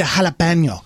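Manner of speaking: JALAPENO with a Spanish h and ny; Spanish pronunciation. Retold or imitated. Telling Leslie about a pepper challenge, JALAPENO in Spanish pronunciation. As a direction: drop a Spanish-sounding JALAPENO into English. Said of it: "I'm doing that now".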